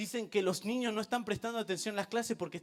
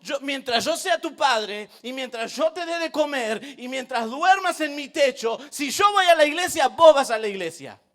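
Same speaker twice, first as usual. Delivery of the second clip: louder, building to a loud final; stern father ultimatum; 6 semitones higher, 12 dB louder than usual. Retold or imitated. Father tells his daughter she goes to church, stern. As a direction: lay down the law to his daughter. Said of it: "Y yo le digo".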